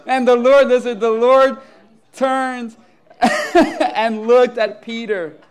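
loud reading broken up by laughter; real laughing. Real, not acted. Preacher resumes scripture after rooster surprise, laughing. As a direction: push on with the reading through laughter.

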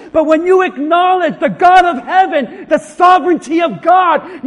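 high, loud, driving pulpit delivery; full-force preaching. Acted. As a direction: pile it on at a shout to the congregation.

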